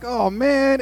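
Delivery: high, dismayed exclamation; dismayed oh man. Retold or imitated. Dad reacting to son's wasp sting, high dismay. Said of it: "I'm like".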